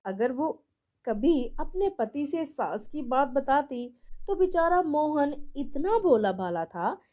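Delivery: ordinary delivery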